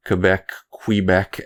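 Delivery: imitating a teacher saying "Quebec, Quebec" the French way, slow; teacher's French Quebec. Recalling a college teacher, slowly saying Quebec the French way. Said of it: "as my, one of my teachers in college used to call it".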